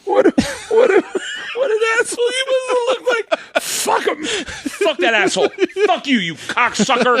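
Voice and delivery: high-pitched squeal through laughter; mocking laughter. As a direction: crack up while mimicking the insult. Mocking his insult in hysterics.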